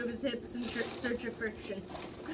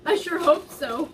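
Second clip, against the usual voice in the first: giggly; 5 semitones higher, 8 dB louder than usual. Acted. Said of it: "Josh giggled".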